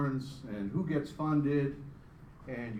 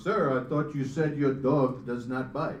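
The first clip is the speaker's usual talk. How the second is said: louder, slow, indignant mock French accent; 8 dB louder than usual. Bitten detective complains to dog owner, mock French accent. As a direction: protest in a hammed-up French accent. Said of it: "with that weird French accent".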